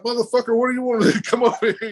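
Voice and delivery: high, exasperated retort with a laugh; mock angry challenge. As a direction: act out picking a fight. Acted quarrel at the table.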